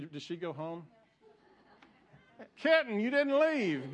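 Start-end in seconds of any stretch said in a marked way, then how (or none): 2.6-3.9 raised, higher voice calling across the room. Real, not acted. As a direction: call her name out to the back pews. Pastor calls out to congregant.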